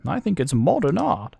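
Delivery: high-pitched character voice; high, theatrical game dialogue. Acted.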